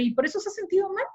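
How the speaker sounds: high, quick incredulous question; dismissive disbelief. Retold or imitated. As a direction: question it as if it were trivial. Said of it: "Así en ese tono".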